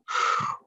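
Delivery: demonstrated audible exhale; outward breath. Shown as a demonstration. Woman showing the outward reaction she had, audible exhale. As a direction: push the breath out in a sigh. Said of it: "like that".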